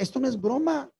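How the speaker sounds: sharp, high protest, a touch of anger; annoyed retort. Retold or imitated. Young man to the laughing girl, a touch of annoyed insistence. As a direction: insist it's no joke, half angry. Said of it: "al extremo que yo me enojé".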